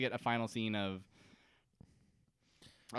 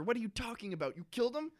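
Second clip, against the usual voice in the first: shocked disbelief; 11 semitones higher, faster than usual. Retold or imitated. Saeed reacting to Russo's confession, shocked and high.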